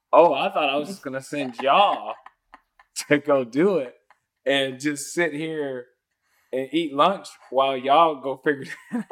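amused at his own cluelessness; laughing mock innocence. Retelling his naive reply.

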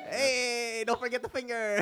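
jokey cartoon Italian; mock Italian. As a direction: ham up an Italian "hey". Mock Italian after accent announced.

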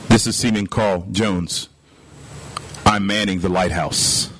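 very low, unhurried punchline reply; calm seaman. Acted. Lighthouse keeper answering the warship captain, calm. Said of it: "responds and says".

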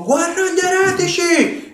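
high, booming angel cry; celestial voice. Retold or imitated. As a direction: shout it like a heavenly warrior. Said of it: "in celeste tipo".

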